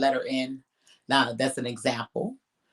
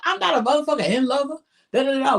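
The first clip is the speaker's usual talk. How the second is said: indignant denial; 10 semitones higher, much faster than usual. Retold or imitated. Imagined angry denial in argument.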